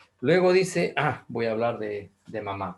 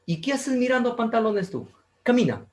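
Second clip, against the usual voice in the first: high, sharp mock mother scolding; stern mother scolding child; 11 semitones higher, 3 dB louder than usual. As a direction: cut the kid off as a stern mom.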